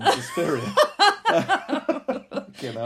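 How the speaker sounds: high squeal through laughter; laughing. Real, not acted. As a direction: crack up mid word. Laughing fit about red film.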